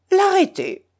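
loud, high-pitched single word; emphatic retort. A narrator's reading. Admiral's abrupt reply to bishop. (réplique forte et aiguë)

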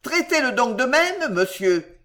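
high, insistent repeated dare; high-voiced demand, falling on "monsieur". A narrator's reading. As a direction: push the challenge up in pitch. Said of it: "d'un ton élevé".

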